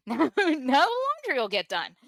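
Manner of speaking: a hint of a callous relative, played light; imagined relieved family. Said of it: "that's how I felt the reaction would be".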